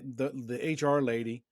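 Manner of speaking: ordinary delivery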